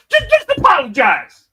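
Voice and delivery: high, loud, pushy little-brother voice; high mock Jey Uso. Imitating Jey snatching the mic, high loud nagging. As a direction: squeak it out like an attack dog. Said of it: "Jay be like".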